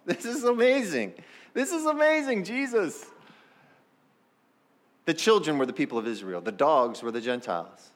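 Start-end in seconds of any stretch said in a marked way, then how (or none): 0.0-3.0 delighted. Real, not acted. Delighted exclamation.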